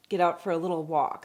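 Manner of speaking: ordinary delivery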